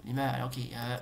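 normal talking voice

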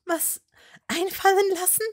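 high, loud incredulous echo; exasperated. A narrator's reading. Hermine to Malfoy, high exasperated echo. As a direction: throw his words back at him. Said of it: "wollte ihn am liebsten schütteln".

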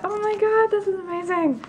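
high-pitched amazed gush; so excited. Real, not acted. Opener amazed by the booster box, so excited. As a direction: squeal it in giddy amazement. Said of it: "I'm so excited".

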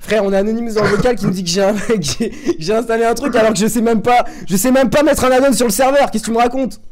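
exasperated. Real, not acted. Outraged denial of hack.

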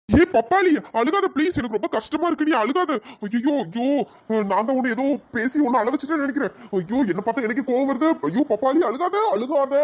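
high, panicky begging; distressed coaxing, voice pushed up high; flustered pleading. Acted. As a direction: plead with her to stop crying. Suitor begs crying girl to stop.

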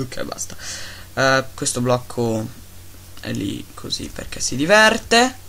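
normal talking voice

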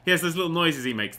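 usual speaking voice

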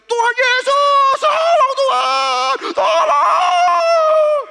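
long, very high screams for help; screaming. Retold or imitated. Imitating a woman in labour next door crying to God, screaming. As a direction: scream in labour agony, dragging out every word. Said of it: "Karena di sebelah itu jerit".

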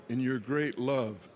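ordinary talk, nothing marked

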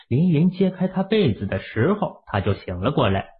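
ordinary talk, nothing marked

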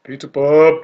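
loud, excited call; excited shout. Real, not acted. Gamer cheers on reaching the Pizza Pub, loud. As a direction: cheer the name out.

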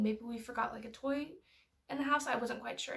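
normal talking voice

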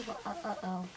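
low, very soft sing-out cue; feed the line under your breath; hushed prompting. Pretend. Hider prompting friend with a line, hushed.

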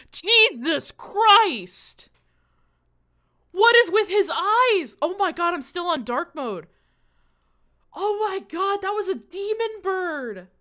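high, loud cry of alarm; startled shriek. Real, not acted. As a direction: shriek in shock at a creepy sight. Startled by the creepy bird on screen.

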